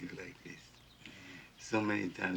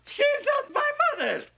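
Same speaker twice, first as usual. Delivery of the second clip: loud and much higher, cutting her off; angry outburst; 14 semitones higher, 8 dB louder than usual. Acted. Son angrily rejecting the woman claiming to be his mother. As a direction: snap the charade shut with force.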